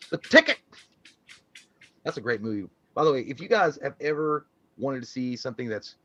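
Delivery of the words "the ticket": excited. Real, not acted. Excited movie reference.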